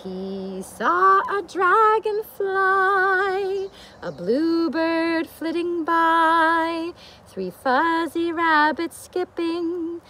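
lively storybook reading; reading to children. A narrator's reading. Teacher reads the bear book to children, storybook voice. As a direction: read it out for a room of little ones.

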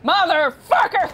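sudden furious curse, loud and high; yelling at the game after getting hit; angry yell. Real, not acted. Frustrated gamer cursing the game, loud.

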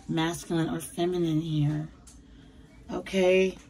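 normal talking voice

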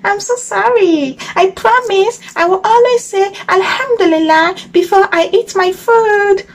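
high, sing-song little frog voice; apologetic little frog. A narrator's reading. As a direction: beg forgiveness in a high childlike pitch. Frog figure apologises to crocodile.

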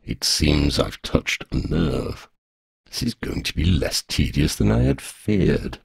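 low, slow, smug voice; enjoy your prey's temper; amused dragon. A narrator's reading. Dragon mocks girl, low smug amusement.

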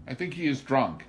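high, loud, shocked disapproval; scandalized. A narrator's reading. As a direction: sound scandalized at the very idea. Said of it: "in a scandalized voice".

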